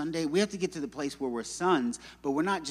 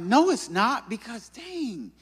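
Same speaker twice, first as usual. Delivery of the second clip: mock indignant denial; 7 semitones higher than usual. Speaker denying pride to colleague, mock indignant. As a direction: push back high, then deflate on dang.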